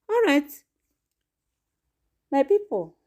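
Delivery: high, loud host wrap-up; lively sign-off call. Real, not acted. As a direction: call out to the audience. Host wraps up loudly.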